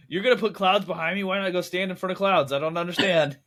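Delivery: high-pitched mock bewilderment; frustrated old man. Podcaster mimics actor confused by projected clouds, mock frustrated. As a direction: imitate an exasperated old actor who can't follow. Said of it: "old man frustrated".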